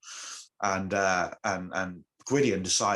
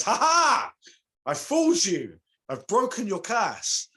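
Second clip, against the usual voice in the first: much higher, loud mocking cackle into a gloat; arrogant gloating; 13 semitones higher, 5 dB louder than usual. Magician gloating to the goddess he tricked, loud and smug. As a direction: crow over her like a smug trickster. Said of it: "feeling quite arrogant".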